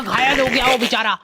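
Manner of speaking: usual speaking voice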